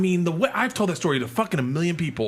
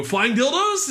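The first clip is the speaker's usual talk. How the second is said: hopeful heckle; 12 semitones higher, 3 dB louder, slower than usual. Retold or imitated. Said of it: "and I go".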